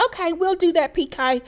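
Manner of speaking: high, mocking put-on posh accent; mock fake accent. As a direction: parody her fake accent, pitched high. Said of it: "Heard that fake ass accent".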